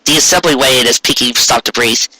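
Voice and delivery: usual speaking voice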